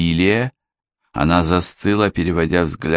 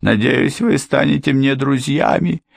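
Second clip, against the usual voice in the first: high, fawning housekeeper's plea; humble pleading; 10 semitones higher than usual. A narrator's reading. Housekeeper pleading with Ford for mercy, high humble. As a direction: throw yourself on their mercy.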